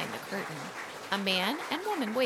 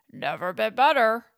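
monster reply; 8 dB louder than usual. Acted. Frankenstein answers.

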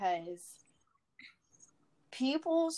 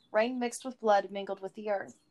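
ordinary delivery, nothing marked

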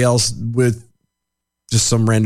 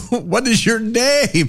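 high, slow put-on voice; laughing through a silly foreign-speaker voice; mock foreign voice; 10 semitones higher, slower than usual. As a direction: play a bewildered foreigner.

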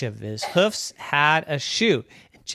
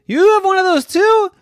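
high squealing disbelief; little pig, loud and astonished; amazed piglet; 10 dB louder than usual. Acted.

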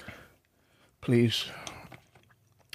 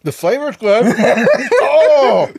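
pained laughter, getting louder; laughing through the burn; 11 semitones higher, 8 dB louder, slower than usual. Real, not acted. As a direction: laugh while your mouth burns. First bite of the hot pepper.

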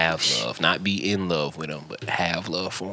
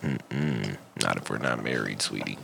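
sassy dismissal; 11 semitones lower, 7 dB quieter than usual. Retold or imitated. Mock reply to viral girl.

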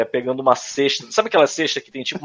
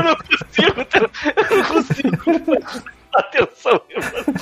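laughing; 10 semitones higher than usual. Real, not acted. Still laughing at the video.

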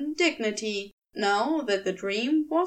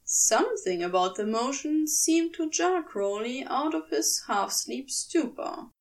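plain reading voice

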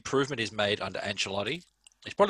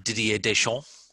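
French pronunciation; slower than usual. Pretend. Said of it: "sounds better when you put the accent".

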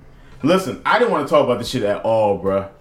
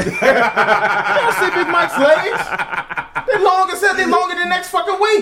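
laughing mock rant; slower than usual. Friend ranting about tall Big Mike's legs, laughing and high. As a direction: ham up the outrage, cracking up.

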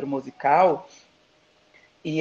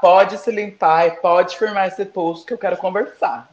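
mock diva command; 4 semitones higher, 8 dB louder than usual. Guest mock-commands nervous host.

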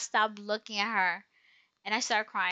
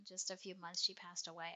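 very soft, subdued explanation; hushed apology; 3 semitones lower, 13 dB quieter than usual. Retold or imitated. Woman explaining her staring to a stranger, hushed apology. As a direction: apologize quietly, almost under your breath.

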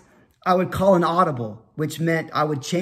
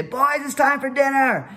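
put-on higher, singsong call to the kids; mom calling out; 7 semitones higher than usual. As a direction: call the boys in from the yard. Mom calling boys in for dinner.

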